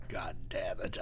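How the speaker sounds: low, whispered mock curse; frustrated whisper. Djinn forced to walk away, cursing in a whisper. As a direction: curse under your breath like a thwarted djinn.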